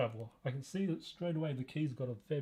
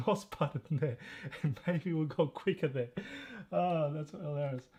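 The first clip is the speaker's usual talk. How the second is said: usual speaking voice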